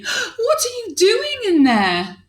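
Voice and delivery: high, half-laughing shocked question; high alarmed princess voice. Acted. Princess asking her father in the pot, high and alarmed.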